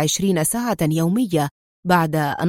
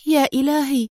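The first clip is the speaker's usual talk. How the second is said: higher-pitched dismay; acted alarm on a short exclamation; dismayed murmur; 6 semitones higher than usual. A narrator's reading. Woman learns they were followed all along, murmuring in dismay. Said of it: "ازداد وجهها شحوبا وهي تغمغم".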